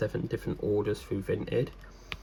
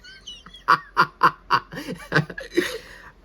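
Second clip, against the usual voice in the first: laughing; 10 semitones higher, 5 dB louder than usual. Real, not acted. Laughing at own situation.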